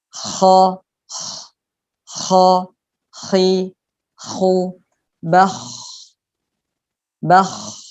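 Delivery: kha, khi, khu, bakh with air rushing out on the kh; breathy kha. Shown as a demonstration. Teacher showing kha with released breath, breathy kh sound. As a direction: let the breath escape on kha. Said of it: "nafasnya terlepas".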